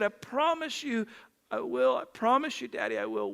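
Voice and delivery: lightly acted tearful little boy giving in; crying child. Crying son promises daddy he will, small-voiced. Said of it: "he was crying".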